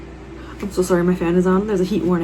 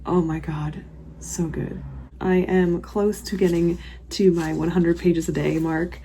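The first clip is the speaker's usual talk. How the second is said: ordinary talk, nothing marked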